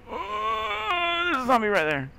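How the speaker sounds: high, loud scared yelp; sudden scared cry; startled cry. Real, not acted. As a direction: yelp in fright. Startled by zombie.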